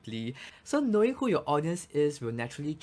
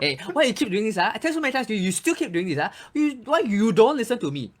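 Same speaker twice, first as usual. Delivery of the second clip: put-on higher, louder nagging parent scolding a kid; 8 semitones higher, 7 dB louder, faster than usual. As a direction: play a parent telling off a child who won't listen. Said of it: "scolded by our parents and scolded by our teachers".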